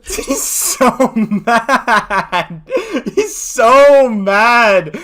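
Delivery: slow and high, laughing through the words. Real, not acted. Streamer laughing at his angry rival, very slow, high and loud. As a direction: cackle out the taunt, very loud.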